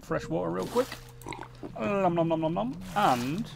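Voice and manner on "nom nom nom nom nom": playful nom-nom eating noise; cartoonish munching sound; mock munching. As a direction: gobble it down in pretend chomps. Mock eating as the character feeds.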